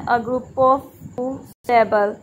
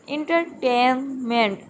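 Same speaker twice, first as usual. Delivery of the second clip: slow entertainment in separate syllables; slower than usual. Shown as a demonstration. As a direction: spell out the beats of entertainment slowly. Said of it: "इसमें आप देखेंगे दो unstressed". (Entertainment धीरे-धीरे, हर syllable अलग)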